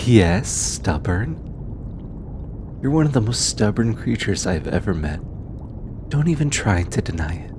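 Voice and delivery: lightly voiced researcher, fond and teasing, with thoughtful pauses. Researcher thanking their stubborn bodyguard, fond and sincere. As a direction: talk gently to a trusted companion, unhurried, hesitating between phrases.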